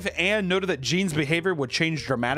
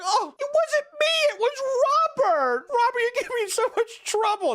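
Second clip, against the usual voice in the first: put-on innocent child voice; 3 dB louder than usual. Gene blaming Robert to Anne, very high. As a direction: fake innocence like a kid. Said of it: "He's like".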